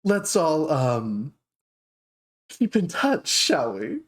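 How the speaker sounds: dark elf shopkeeper greeting surprise customers, high and gushing. Acted. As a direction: coo at unexpected guests. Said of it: "she goes".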